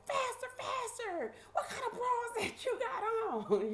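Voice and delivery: high, harrying sheriff shriek; mock screamed scolding, very high; imitated screaming and hollering. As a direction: mimic a guard hollering at stragglers. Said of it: "screaming and hollering like".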